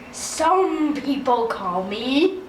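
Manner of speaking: low, drawn-out character voice; slow, low and portentous introduction; wizard introducing himself. Retold or imitated. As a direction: stretch it out low, like a mysterious wizard.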